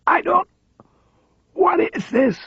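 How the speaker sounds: high, thin voice of a frail paralysed man; frail high voice, rattled; frail old man. A narrator's reading. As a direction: squeeze it out high and weak. Paralysed man's weak high voice.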